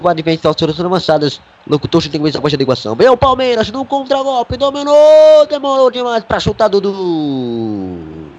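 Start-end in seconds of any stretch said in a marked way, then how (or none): 2.9-8.3 excited commentary. Real, not acted. Commentator calls a counterattack.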